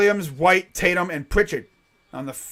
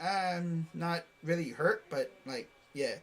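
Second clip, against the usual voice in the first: mock reluctant player; 10 dB quieter, slower than usual. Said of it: "he leaves and he's like".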